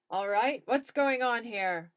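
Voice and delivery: loud officer demanding answers; police officer. A narrator's reading. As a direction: take charge as the law. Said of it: "Officer of the law".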